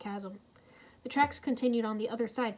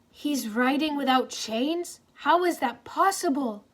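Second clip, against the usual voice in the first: gasping; 4 semitones higher, 6 dB louder, slower than usual. A narrator's reading. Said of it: "Jet Jack gasped".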